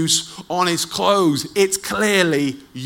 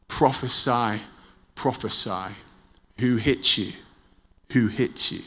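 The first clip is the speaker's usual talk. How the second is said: usual speaking voice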